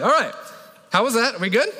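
normal talking voice

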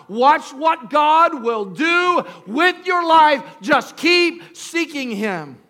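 slow, high, ringing preacher's call; preaching at full force. Acted. Preacher urging his congregation on, slow and ringing. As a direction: lift it out slow over the crowd.

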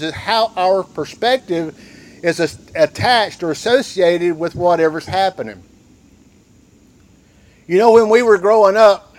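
ordinary talk, nothing marked

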